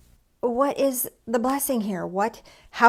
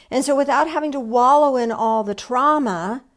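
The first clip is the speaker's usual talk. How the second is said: ordinary delivery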